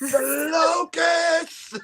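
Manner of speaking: very high, long drawn-out cry; mock dramatic outcry. Host mocking the sequel's locusts, hammed-up high wail.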